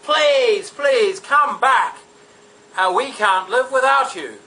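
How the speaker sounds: loud, high, hammed-up pleading; begging Britons. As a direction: beg them to come back, overdoing it. Britons begging the Romans back.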